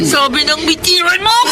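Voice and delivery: desperate shout to let go. Acted. Jessica shouting at Jimbo to let go of her. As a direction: yell at him to release you. Girl struggles against her captor.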